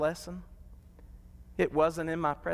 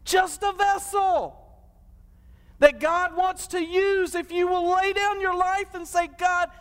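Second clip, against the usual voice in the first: much higher, slow, shouted plea; impassioned altar call; 9 semitones higher, slower than usual. Acted. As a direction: call them forward with everything you have. Altar call at full force.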